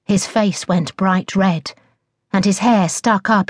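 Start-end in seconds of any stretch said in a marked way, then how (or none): none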